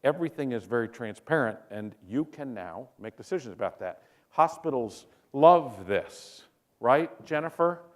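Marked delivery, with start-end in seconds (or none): 4.3-6.5 drawn-out sarcastic stress on love; slow and dripping with irony. Real, not acted. Speaker mocking hospitals to audience, sarcastic slow drawl.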